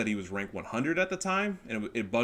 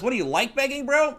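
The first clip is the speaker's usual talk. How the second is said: high, loud mock-heckler voice rising at the end; 14 semitones higher, 7 dB louder than usual. Imagined viewer accusing the streamer, high mocking voice. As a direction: jeer it as a squeaky critic.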